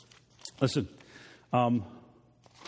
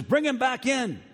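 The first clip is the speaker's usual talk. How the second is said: commanding king; 10 semitones higher than usual. Retold or imitated.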